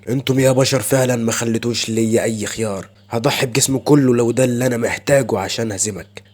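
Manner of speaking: plain reading voice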